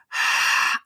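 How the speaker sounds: demonstrative exhale. Shown as a demonstration. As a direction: huff a breath out to show it off. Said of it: "told me to do this".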